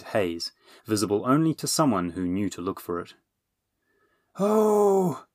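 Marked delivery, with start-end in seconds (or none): 4.4-5.3 high, sudden gasp of alarm; a startled dragon crying out; dragon's startled cry. Acted. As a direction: yelp it out in a shock. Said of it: "the dragon cried".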